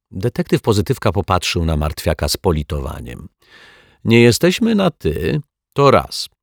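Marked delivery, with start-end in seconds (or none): none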